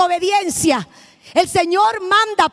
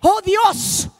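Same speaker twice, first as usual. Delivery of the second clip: woman in turbulence crying out in fear; retold frightened cry; 4 semitones higher, much slower than usual. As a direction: mimic the passenger's scared outburst. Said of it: "y grita".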